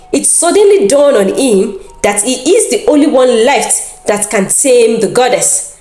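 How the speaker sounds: plain reading voice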